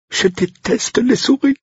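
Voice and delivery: high, slow, shivering voice; shuddering disgust. Acted. Cook confessing her fear of mice, high shuddering voice. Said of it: "frissonna-t-elle".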